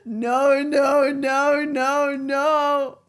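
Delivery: loud run of drawn-out refusals; loud, stubborn objection; loud protest. Real, not acted. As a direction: belt out a real, insistent denial. Disputes correction of pronunciation.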